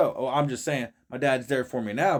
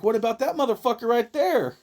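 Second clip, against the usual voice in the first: higher, amused retort; incredulous kid; 9 semitones higher, 3 dB louder than usual. Retold or imitated. Kid retorting to the congregation, amused and incredulous. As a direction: point back at them laughing. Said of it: "And I'm like".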